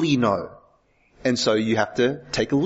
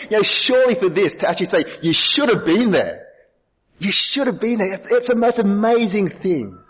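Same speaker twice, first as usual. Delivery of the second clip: excited; 7 semitones higher than usual. Acted. Imagining Sonny Bill's thrilled words.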